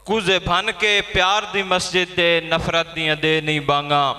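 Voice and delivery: poem recitation. Acted. Poet recites verse at gathering.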